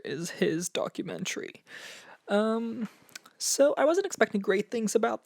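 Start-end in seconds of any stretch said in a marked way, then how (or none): none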